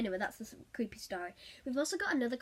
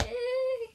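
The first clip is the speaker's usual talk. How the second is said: disgusted squeak; 12 semitones higher, 3 dB louder than usual. Retold or imitated. Reacting to creepy roleplay.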